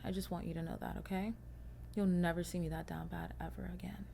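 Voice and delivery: hushed, breathy promise "You'll never see me that down bad ever again"; whispered vow to viewers. Real, not acted. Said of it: "repeated in a whisper".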